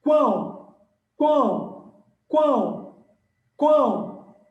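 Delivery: "Quão" repeated loud and high, falling each time; triphthong demo. Shown as a demonstration. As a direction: punch out "Quão" as one mouth movement. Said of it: "Quantos movimentos você fa".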